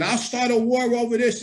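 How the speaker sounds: high-pitched mock yell, not full volume; mild, high imitation of yelling; retold scream. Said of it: "So now John's screaming".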